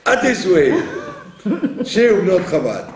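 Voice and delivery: ordinary delivery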